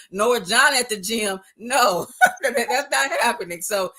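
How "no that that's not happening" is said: laughing refusal. Real, not acted. Refusing gym invite, joking.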